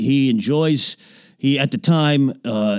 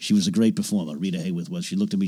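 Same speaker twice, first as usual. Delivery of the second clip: normal talking voice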